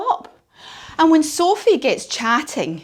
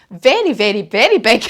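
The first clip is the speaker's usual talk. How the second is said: VERY punched louder on each repeat; emphatic very very big; 6 dB louder than usual. A narrator's reading. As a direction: lean hard on each very. Storyteller stressing giant's size.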